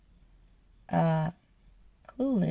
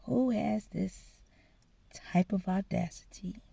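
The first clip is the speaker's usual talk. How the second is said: thinking to oneself; 3 semitones lower, 10 dB quieter than usual. Retold or imitated. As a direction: let it sink almost to a whisper. Inner question, thinking to herself.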